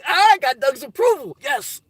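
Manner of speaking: high, gleeful boast; delighted brag to the chat; excited. Real, not acted. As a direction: crow about getting the approval. Celebrating a viewer's go-ahead.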